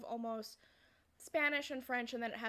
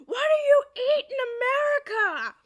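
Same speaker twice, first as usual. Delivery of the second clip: full-volume, high-pitched baffled yell; exasperated shout; 17 dB louder, faster than usual. Retold or imitated. Dad's mind is completely blown, he shouts in bafflement. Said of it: "he just shouts".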